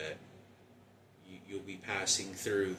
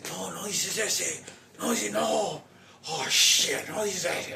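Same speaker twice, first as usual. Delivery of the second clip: much higher, much louder furious shout; dad's angry shout; 12 semitones higher, 11 dB louder, slower than usual. Retold or imitated. Father yelling at the mother in Spanish, furious shout. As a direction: explode like the father shutting her down. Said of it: "my dad would get mad and say".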